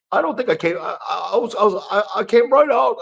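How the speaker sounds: high-pitched, stammering imitation of excuses; mocking the referee. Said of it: "And he says".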